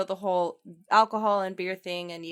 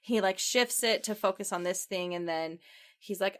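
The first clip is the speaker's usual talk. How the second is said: usual speaking voice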